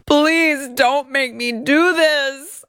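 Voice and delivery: high, slow whiny mock plea; mock pleading. Reading the complaint, mocking the plaintiff begging Hajini. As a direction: beg in a hammed-up whimper. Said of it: "again begged".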